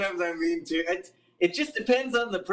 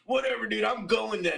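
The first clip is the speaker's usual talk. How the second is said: pissed-off fan; 7 semitones higher than usual. Retold or imitated. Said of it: "they'll get pissed off and they'll be like".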